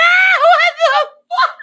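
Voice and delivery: very high, loud mock scream through laughter; imitated scream. Host copying the man's shock at manhole drinking, screaming. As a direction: imitate his shriek, cracking up and drawing it out. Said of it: "I screamed the same thing".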